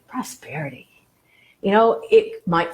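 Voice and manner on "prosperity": prosperity breathed out much softer, trailing away; fading out. Shown as a demonstration. Teacher letting the felt word fade, drifting much softer. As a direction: let prosperity dissolve into near silence. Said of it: "Just kind of feel into that".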